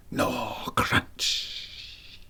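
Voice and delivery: breathy unvoiced gnaw and crunch sounds; whispered gnawing. A narrator's reading. Narrator whispering the rat chewing at the door. Rat noises voiced in story.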